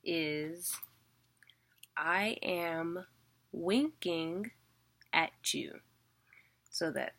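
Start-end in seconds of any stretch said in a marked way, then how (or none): 2.0-5.8 "I am winking" with "am" and "wink" hit harder; unstressed then stressed. Shown as a demonstration. Teacher gives students a mnemonic, stressing "am" and "wink". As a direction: say "I am winking at you" stressing "am" and the first syllable of "winking". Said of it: "a cute little way I thought of remembering it".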